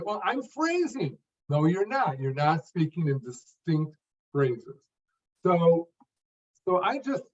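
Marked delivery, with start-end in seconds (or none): 0.0-1.2 mock defensive. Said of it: "they'll say".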